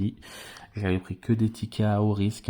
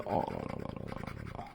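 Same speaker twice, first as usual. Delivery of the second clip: whispered dismay; 13 dB quieter, faster than usual. Real, not acted. Tirage sans voyelles.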